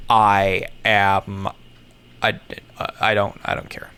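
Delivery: ordinary talk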